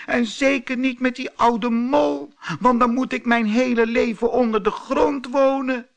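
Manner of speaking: high, loud and crying; wailing against the marriage; tearful high protest. A narrator's reading. Thumbelina bursts into tears at the mouse, loud tearful wail. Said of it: "huilde ze".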